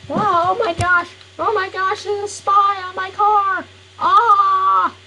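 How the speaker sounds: high-pitched panicked shriek; freaking out. Acted. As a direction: squeal like a kid who just spotted a spy on the car. Character panics at spy on car.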